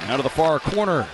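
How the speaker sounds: ordinary talk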